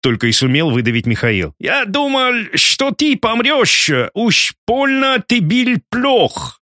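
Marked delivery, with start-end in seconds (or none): 1.6-6.6 put-on high, slow German-accented Russian; German struggling with Russian words; broken Russian, German accent. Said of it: "коверкая русские слова".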